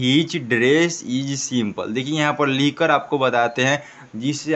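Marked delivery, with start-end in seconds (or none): 0.0-1.9 very slow, each word spelt out for pupils; slow model reading. Shown as a demonstration. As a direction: read it out slowly like a teacher. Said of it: "देखिए क्या लिखा है". (धीरे-धीरे साफ़ पढ़कर सुनाना)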